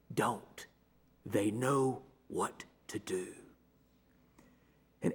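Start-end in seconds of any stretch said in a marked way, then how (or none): 0.1-3.3 low, hushed voice of instinct; inner instinct. Retold or imitated. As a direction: quietly caution yourself, pitched well down. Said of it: "some instinct said to me".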